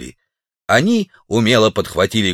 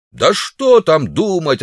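dismissive heckle; 7 semitones higher, slower than usual. A narrator's reading. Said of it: "откликнулся кто-то из зала".

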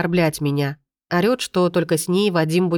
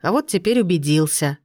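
usual speaking voice

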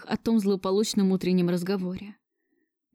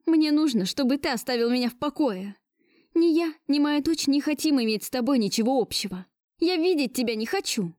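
higher-pitched, emphatic rejection; upset cry; 6 semitones higher than usual. A narrator's reading. Woman telling Gleb to leave her alone, mild cry. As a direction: cry it out, barely holding on. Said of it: "крикнула я, держась из последних сил".

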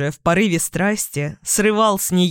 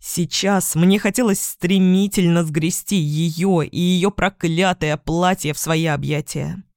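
usual speaking voice

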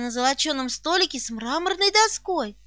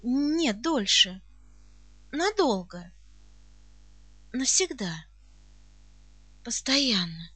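low, soft words with grave pauses; very serious; 5 semitones lower, 6 dB quieter, slower than usual. A narrator's reading. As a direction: measure out each word seriously. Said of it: "спросила очень серьёзным тоном". (очень серьёзно, негромко)

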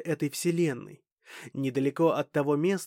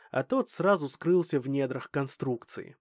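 plain reading voice

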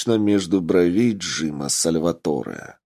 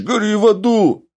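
much higher, loud screaming curse; hysterical shriek; 13 semitones higher, 6 dB louder than usual. Acted. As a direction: shriek it at the top of the voice. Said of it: "истерично заорал раненый".

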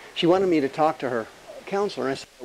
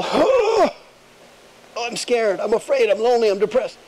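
mock fearful whine; 5 semitones higher than usual. Mocking a fearful person.

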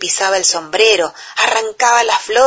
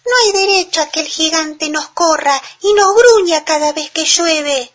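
a tiny chick speaking up bravely; 8 semitones higher, 3 dB louder than usual. A narrator's reading. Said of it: "se subió a un banquito y dijo".

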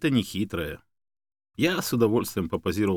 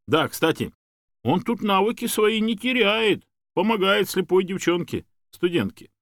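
high, animated helper's voice; hearty Yuri, reassuring; 7 semitones higher, 3 dB louder than usual. A narrator's reading. Man praising the dog to his owners, enthusiastic. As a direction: wave off thanks, then boast of the dog.

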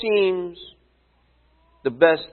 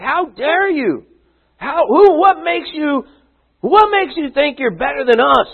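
mock indignant; 10 semitones higher than usual. Ten disciples scolding James and John, high indignation. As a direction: sound scandalized and offended. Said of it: "It's like".

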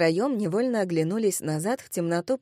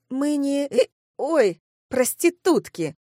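high, slow, hiccup-broken words; tipsy protest; 6 semitones higher, 4 dB louder, slower than usual. Acted. Drunk Kelsey defends the girls to woman, protesting.

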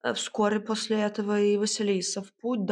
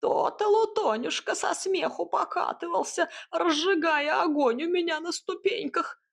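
high-pitched mocking jibe; sarcastic hut; 10 semitones higher than usual. A narrator's reading. Hut mocks the cat's war song, high and sarcastic. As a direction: needle with high, lilting sarcasm. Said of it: "съязвила избушка".

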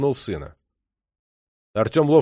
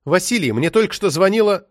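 higher, agitated launch without a greeting; irate woman on the phone; angry ex-wife; 7 semitones higher, 5 dB louder than usual. A narrator's reading. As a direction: blurt it out crossly in a raised pitch. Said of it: "Она была настолько рассержена".

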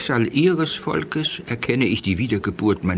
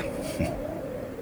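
soft breathy laugh; 10 dB quieter than usual. Acted. Alien elder moved, soft laugh.

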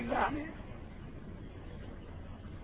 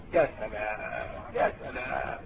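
much lower, soft admiring murmur; awed sigh; 12 semitones lower, 7 dB quieter, slower than usual. Real, not acted. Preacher marvels at the Prophet's words, low and hushed.

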